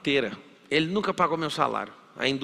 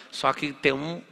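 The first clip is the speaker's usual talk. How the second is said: ordinary talk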